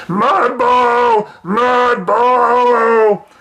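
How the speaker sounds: high, loud cheering chant; troll cheer. Acted. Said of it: "The trolls laughed and cheered".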